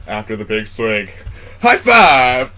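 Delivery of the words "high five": loud celebratory call; triumphant shout. Real, not acted. Drinker celebrates not coughing after big swig, loud triumphant shout. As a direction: shout it out in victory.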